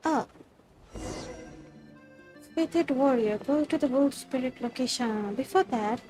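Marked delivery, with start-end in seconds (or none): none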